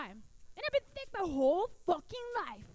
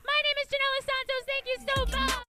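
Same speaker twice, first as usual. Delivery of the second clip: loud sign-off; 9 semitones higher, 9 dB louder than usual. Real, not acted. Comic signing off loudly.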